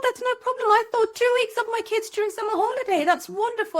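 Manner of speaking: put-on high, bright, breezy confidence before the kids wore her down; mock cheerful, high and loud; naive optimism. Mother recalling her summer plans, mock cheerful high voice.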